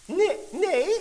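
high, loud squeaky protest; a little mouse insisting it isn't so; squeaky mouse denial. Acted. Mouse denying to Meis that he can talk, squeaky high protest. Mouse denies talking, quarrel.

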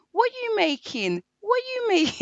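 high, eager mock question; nosy colleague asking. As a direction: pester her like a curious coworker. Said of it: "I'm the one asking".

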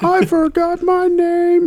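very high, slow silly squeak; squeaky mock voice. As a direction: do a dopey high cartoon voice. Mocking the voiceover.

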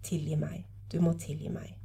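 soft, low murmured plea; low, near whisper. A narrator's reading. Wife in hospital asking her husband's forgiveness, low and hushed. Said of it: "Stemmen hennes var lav, nesten hviskende".

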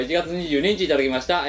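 ordinary delivery

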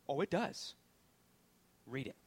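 ordinary talk, nothing marked

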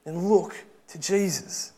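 preach. Acted. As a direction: urge the congregation toward Jesus with full conviction.

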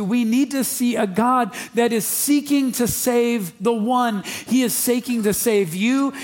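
high, loud, driving sermon voice; full-force preaching. Acted. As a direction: preach it out to the whole room.

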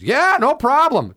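high, drawn-out mock-cheerful yes; eager agreement. Bartender handing comic a free beer, high and breezy. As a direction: sing out an easy, over-eager okay.

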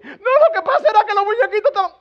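high, loud mock kid; child making excuses. Child excusing himself over cartoons, high mock protest. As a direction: plead like a child caught out.

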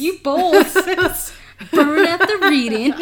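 high, loud imitation of the brother, laughing; brother's voice. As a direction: mimic the brother while cracking up. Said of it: "his voice".

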